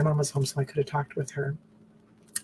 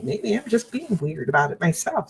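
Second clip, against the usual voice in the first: ordinary talk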